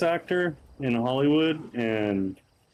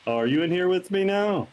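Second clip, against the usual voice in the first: normal talking voice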